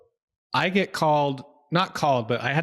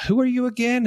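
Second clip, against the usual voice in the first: surprised; 12 semitones higher, 4 dB louder than usual. Retold or imitated. Retelling his confused reply to caller.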